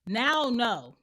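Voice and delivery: loud, cutting comeback; emphatic refusal. Acted. Refusing someone who asked to ask a question, loud and emphatic. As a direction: shut the asker down loudly. Snapping back at the interrupter.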